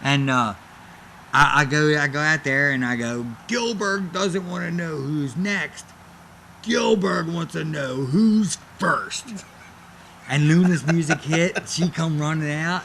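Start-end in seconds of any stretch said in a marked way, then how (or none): 3.5-9.4 slow, drawn-out challenge, pitched up a little; ring challenge. Retold or imitated. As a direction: announce it slowly, like a wrestler's open challenge. Acting out his open challenge in ring.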